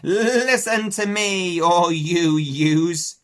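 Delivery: put-on loud, high, slow old ewe addressing all; Ma addressing the flock. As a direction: call out to the whole flock. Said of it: "Raising her voice".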